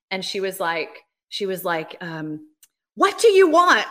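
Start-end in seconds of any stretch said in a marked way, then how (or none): 2.9-3.9 sudden loud demand; an older lady yelling the question. Retold or imitated. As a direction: bark the question at him out of nowhere. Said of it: "didn't expect her to yell at me".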